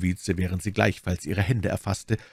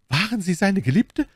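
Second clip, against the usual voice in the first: raised, energetic jealous question; forceful demand; 13 semitones higher, 4 dB louder than usual. A narrator's reading. Bonacieux questions Milady about her lover, with jealous energy. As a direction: press her hard for the answer. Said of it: "mit einer Energie fort".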